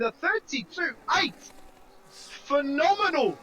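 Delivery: excited play-by-play as the car comes home; live race commentary. Real, not acted. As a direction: call the finish with building excitement.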